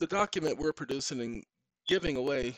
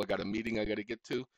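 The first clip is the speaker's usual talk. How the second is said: ordinary talk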